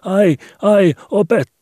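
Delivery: very high, loud yelps of pain; boy's panicked high cry; terrified scream. A narrator's reading. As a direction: shriek in terror, cut off mid-word. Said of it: "Skobe huusi kauhuissaan".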